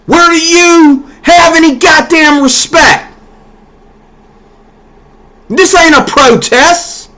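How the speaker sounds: slow, high, hammering outrage; mad. Real, not acted. Fuming at the Union address crowd, slow and high. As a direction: let the anger climb, drag every word. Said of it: "Now I'm mad".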